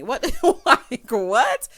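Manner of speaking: loud laughing what; cackling incredulous what; laughing disbelief. Real, not acted.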